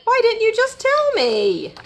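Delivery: high-pitched exasperated whine. A narrator's reading. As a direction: complain up high, falling at the end. Rabbit exasperated at Robot.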